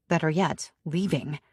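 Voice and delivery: ordinary delivery, nothing marked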